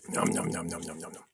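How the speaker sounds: munching popcorn noises; chewing sounds with mouth full. Real, not acted. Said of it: "You want popcorn?".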